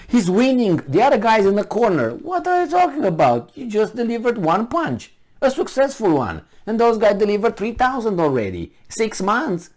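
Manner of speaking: normal talking voice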